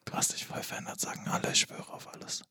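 angry whisper; whispered bickering. Pretend. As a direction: argue in a whisper. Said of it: "Aber flüsternd".

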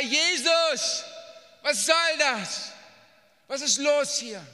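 high, loud cry of protest; desperate shout. Acted. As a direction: shout your complaint up to Jesus. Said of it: "Und schrei". (laut klagender Schrei)

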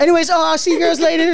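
high, loud mock farewell; exaggerated friendly send-off; cheery goodbye act. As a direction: call out a sing-song goodbye to favorite customers. Acts cheery goodbye to her friends.

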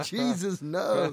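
high, loud comic protest; pleading disciples. Retold or imitated. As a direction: blurt it out as an alarmed disciple begging. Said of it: "I could just imagine the disciples going".

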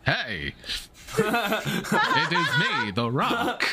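high, laughing bad Rock voice; hammed-up Rock, cracking up mid-line; The Rock impression. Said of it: "a Dwayne The Rock Johnson impression".

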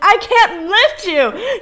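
high, loud, drawn-out mock wail; retold screaming. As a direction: act out a desperate scream slowly. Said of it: "screaming at him".

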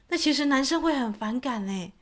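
strong emphatic warning. Real, not acted. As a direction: drive the warning home. Stressing men dislike it.

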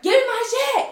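high, loud, pissed-off order; aggressive demand. Retold or imitated. Woman reaching into police car for her ID, aggressive demand. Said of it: "I'm getting very aggressive 'cause now I'm pissed off".

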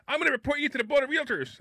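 high, complaining imitation of a pushy realtor; whiny realtor voice. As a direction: realtor yelling at the broker, high mocking voice. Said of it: "Can you do the rest of the show with that voice".

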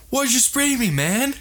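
angry. Retold or imitated. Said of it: "except he was, like, mad".

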